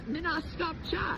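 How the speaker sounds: playfully defiant, pitched far up; high voice dropping at the very end; high-pitched playful reply. Retold or imitated. Telling the cashier she keeps talking, high playful voice.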